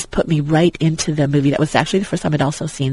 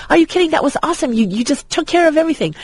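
much higher, eager, retold gush; imitating a thrilled young man, pitched well up; amazed excitement; 11 semitones higher, faster than usual. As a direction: play it as a wide-eyed hero thrilled by the rescue. Jake's amazed reply, retold.

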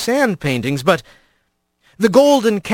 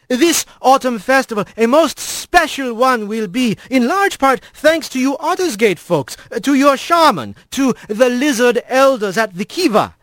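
higher, grand ceremonial speech; squirrel priest voice; 7 semitones higher than usual. A narrator's reading. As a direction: proclaim like a proud old priest. Alphonse's festival speech.

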